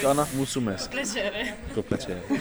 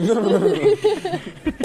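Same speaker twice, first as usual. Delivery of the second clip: sudden high burst of laughter; 13 semitones higher than usual. Real, not acted. Friends laughing at names.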